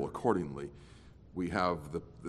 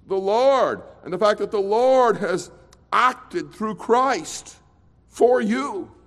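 preaching; 11 semitones higher, 9 dB louder than usual. Acted. Preaching at full force.

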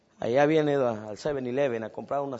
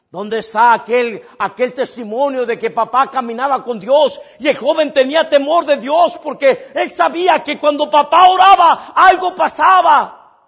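fiery preaching; 12 semitones higher, 20 dB louder than usual. Acted. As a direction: preach it with full force. Preacher raises to full force.

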